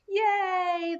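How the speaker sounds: high, excited cheer of delight; children shouting. A narrator's reading. Children cheer at Dad's idea, excited. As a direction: kids cheering at a treat. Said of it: "the children shouted".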